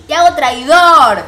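very loud, high, stretched-out accusing yell; shouting from outside. Retold or imitated. Outsiders denouncing Tiago to the house, drawn-out shout. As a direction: holler the accusation, dragging out the words. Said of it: "Gritaron desde afuera".

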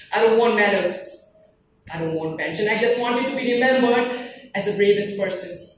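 proud declamation, rising in force; emphatic closing recitation. Acted. Soldier asking to be remembered, emphatic declaiming. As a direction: declaim the last wish firmly.

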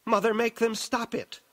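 high, youthful plea; pleading. A narrator's reading. Frightened daughter turning to her mother, pleading. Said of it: "She turned pleading eyes to Jessica".

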